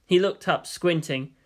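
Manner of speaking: usual speaking voice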